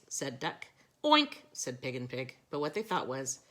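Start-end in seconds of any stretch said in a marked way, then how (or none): 1.0-1.4 high, loud pig oink; mock oink. A narrator's reading. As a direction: oink like a piggy. Pigs oink.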